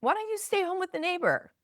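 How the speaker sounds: high-pitched, quick sarcastic retort; sarcastic dad. Retold or imitated. Dad teases his oldest child who spoke up, mock-sweet sarcasm. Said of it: "a very sarcastic sense of humor".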